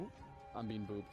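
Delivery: normal talking voice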